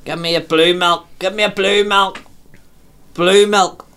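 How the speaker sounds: put-on insistent drunk demand. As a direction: call it out like a stubborn drunk. Drunk insisting at garage hatch.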